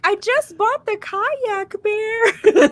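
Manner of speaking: high, slow whiny reasoning; pleading with a bear. Retold or imitated. The annoying girl reasoning with the bear, high and slow. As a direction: plead with the bear like it owes you. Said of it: "trying to level with him".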